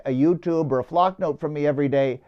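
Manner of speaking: normal talking voice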